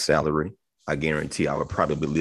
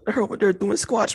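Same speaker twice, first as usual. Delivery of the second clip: gym bro voice. Retold or imitated. Acted gym bro catcalling.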